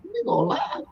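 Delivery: a surprised double take, retold; retelling his shock at seeing a friend live; mock surprise. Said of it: "I was like".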